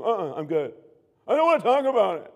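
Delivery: mock teenager refusing to talk; irritated teen brush-off. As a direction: brush the parent off, fed up.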